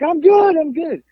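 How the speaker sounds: high-pitched, acted over-assurance; emphatic insistence. Drunk man answers friends who doubt him, acted emphatic insistence. Insists after being asked if sure.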